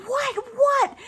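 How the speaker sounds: high, loud alarmed questioning; startled wife. Retold or imitated. Wife reacting to her screaming husband, startled shriek. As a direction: imitate a wife jolted by screaming. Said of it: "his wife is like".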